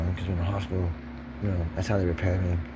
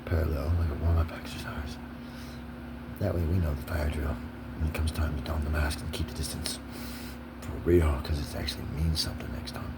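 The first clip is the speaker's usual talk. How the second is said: hushed whisper, softer than usual; a soft near-whisper; 3 semitones lower, 4 dB quieter than usual. Real, not acted. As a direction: keep it low, half whispered. Whispering through opening remarks.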